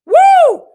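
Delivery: high, loud whooping woo. Real, not acted. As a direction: let out a big woo. Exclaiming before other cultures.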